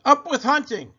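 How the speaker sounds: high, loud war-chant; storybook tyrannosaur cheer; shouting. A narrator's reading. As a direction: shout it like a rallying cry. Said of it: "And they shouted".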